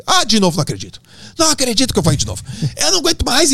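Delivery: high-pitched mock complaint; hammed-up exasperation; exasperated mother. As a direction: imitate a fed-up mother protesting on the phone. Retold mother fed up on phone.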